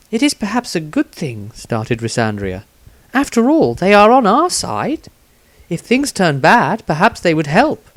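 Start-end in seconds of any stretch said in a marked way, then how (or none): 0.1-1.5 higher, lightly voiced young woman asking; a curious female companion, voiced higher; Rysandria's voice. A narrator's reading. 3.1-7.8 higher, lightly voiced young woman asking; a curious female companion, voiced higher; Rysandria's voice. A narrator's reading.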